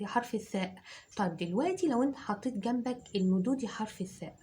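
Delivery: normal talking voice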